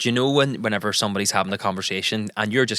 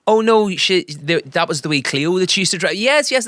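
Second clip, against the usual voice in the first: higher, louder eager interjection; husband translating for his wife, pitched up; helpful husband chiming in; 8 semitones higher, 4 dB louder than usual. Retold or imitated. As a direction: jump in brightly to help out. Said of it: "I'd be like".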